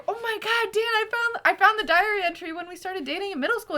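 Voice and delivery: high, rushing, gushing retelling; excited news. Retold or imitated. Telling Dan about the old diary entry, excited.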